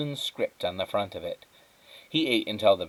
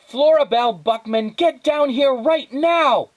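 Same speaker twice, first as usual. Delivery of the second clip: high, loud, slow furious yell; angry shout; 10 dB louder than usual. Acted. Mother yelling her daughter downstairs, angry shout. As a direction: bellow the full name.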